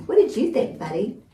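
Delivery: ordinary delivery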